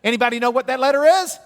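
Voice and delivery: high, loud and fast quiz-host call; loud game-show quiz. Acted. Teacher quizzing the class on Hebrew letters, loud and high.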